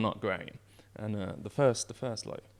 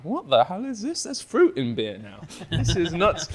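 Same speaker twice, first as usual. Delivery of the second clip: mock amazed; 7 semitones higher, 10 dB louder, slower than usual. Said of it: "I'm like".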